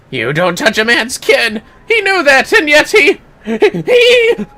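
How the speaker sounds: very high, loud, breaking into laughter; hammed-up furious confession. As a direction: rage about the sister, cracking up. Voices killer confessing, laughing.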